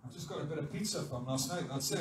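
quick, soft, low mumble; acted low-key confession; sheepish admission. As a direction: quietly own up to it. Disciple voiced admitting forgotten food.

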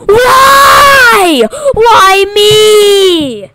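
high, loud crying wail; anguished shout. Real, not acted. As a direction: wail it out in despair. Chosen to be killed.

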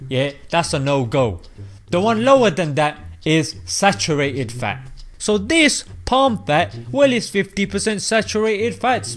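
normal talking voice

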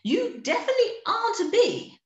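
high, stately queen; queen bee addressing the bear kindly; Queen's voice. Acted. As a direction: speak as a gracious bee queen. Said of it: "said the Queen".

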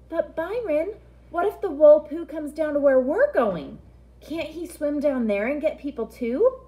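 pleading with real worry; scared little girl. Acted.

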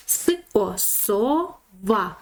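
"С-о, сова": split off the first syllable, then the whole word. Shown as a demonstration. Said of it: "перший склад буде со". (по складах: "С-о, сова")

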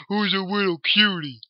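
put-on crooning baby voice again; baby talk. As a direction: coo it at the dragon. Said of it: "don't talk baby to him".

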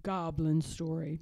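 usual speaking voice

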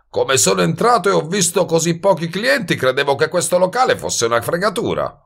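high, animated hustler; surprised wise guy. Acted. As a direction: admit surprise in a brash, high voice. Voices Goebel, pleasantly surprised. (sbruffone sorpreso, voce acuta)

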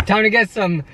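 excited. Real, not acted. Excited announcing food after workout.

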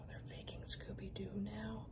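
sighed, breathless whisper. Real, not acted. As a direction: whisper it in dismay. Disbelief at fake figure.

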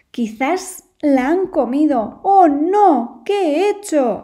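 slow then loud, high cry of dread; anguished howl. Acted. Wolf fearing for the lost lamb, loud anguished cry. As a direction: wail it in panic. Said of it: "aulló el lobo".

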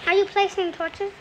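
ordinary talk, nothing marked

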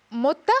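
"متة" for Monica, cut to two syllables; mispronounced name. Retold or imitated. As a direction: imitate a child shrinking Monica to "متة". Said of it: "مونيكا بيقولها". (نطق طفولي لاسم مونيكا "متة")

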